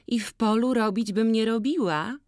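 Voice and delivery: put-on high, loud, bright-eyed girl complaining of the seller; lively village girl. Jagna asking Boryna to judge the cap, high and loud. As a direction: pitch it up and call out to Maciej.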